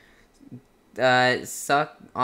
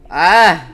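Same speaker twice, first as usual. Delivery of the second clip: sharp loud yelp of shock; startled scream; 4 semitones higher, 11 dB louder than usual. Real, not acted. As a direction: cry out suddenly. Sudden scream during gameplay.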